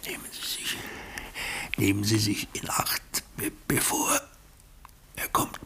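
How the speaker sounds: unvoiced, fading warning; dying whisper. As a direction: warn him with the last breath. (geflüstertes Sterben)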